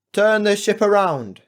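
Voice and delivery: loud, high shouted order; captain's cry. A narrator's reading. Captain ordering the ship around, urgent shout. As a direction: bellow it across the deck. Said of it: "cried our captain".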